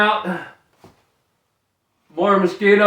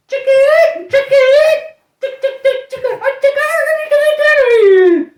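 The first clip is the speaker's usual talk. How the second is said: chicken squawk; 7 dB louder than usual. Pretend. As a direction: squawk "chicken" very high and loud like a hen. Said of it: "what if animals made the sounds".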